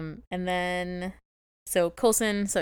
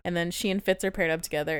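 normal talking voice

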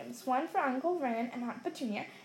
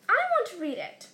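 fierce; 7 semitones higher, 5 dB louder than usual. A narrator's reading. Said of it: "said Harry fiercely".

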